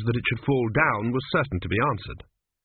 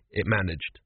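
plain reading voice

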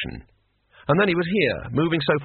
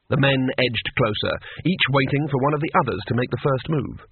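usual speaking voice

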